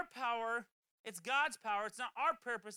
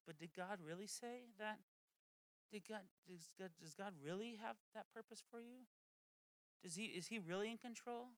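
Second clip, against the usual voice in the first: 1 sly tempter; 2 semitones lower, 10 dB quieter than usual. Retold or imitated. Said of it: "Satan just goes".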